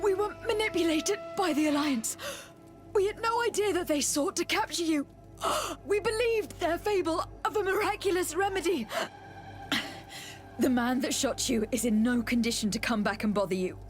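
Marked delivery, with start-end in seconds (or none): 0.0-8.8 high, urgent self-defence; pleading explanation in a raised pitch; earnest protest. Acted. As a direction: protest your innocence, pitched well up. Pleads innocence after the attack.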